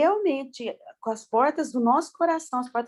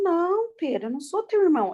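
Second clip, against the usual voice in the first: higher-pitched, quick mock protest; retold puzzled denial; 6 semitones higher than usual. Swimming instructor correcting a little boy, lightly acted puzzled denial. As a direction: imitate a taken-aback correction.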